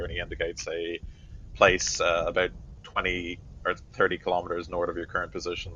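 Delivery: ordinary talk, nothing marked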